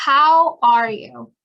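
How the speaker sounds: slow and distinct; slow model question. Shown as a demonstration. Teacher modeling a greeting slowly for learners. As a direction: draw the question out clearly. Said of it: "Y van a escuchar".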